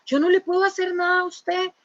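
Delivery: imitando al drogado, más agudo; voz del drogado. Retold or imitated. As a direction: remedo ligero de un hombre drogado. (un drogado le habla a la hermana, voz aguda)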